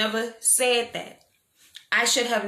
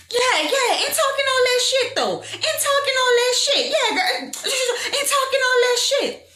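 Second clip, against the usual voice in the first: very high, mocking squeal of a voice; high-pitched, hammed-up taunt; mimicked heckling; 4 dB louder than usual. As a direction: imitate a loudmouth calling out down the line. Imitates woman heckling down the line.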